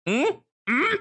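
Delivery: high-pitched questioning "mm?"; short, high wordless question; questioning hum. Acted. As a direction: hum a high query at someone. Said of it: "looks over at Lee and like".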